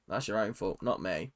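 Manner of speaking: "that's your own fault" dropped low and soft; quiet aside. Real, not acted. Vlogger mutters to fans copying her, quietly, dropping "that's your own fault" low. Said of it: "repeated quieter".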